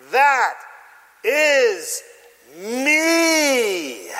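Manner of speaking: high, loud "that", "is", "me", each set apart; emphatic punchline. Shown as a demonstration. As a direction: pause between words and stretch out "me". Punchline of the sermon.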